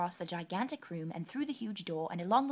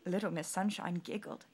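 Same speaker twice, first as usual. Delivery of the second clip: usual speaking voice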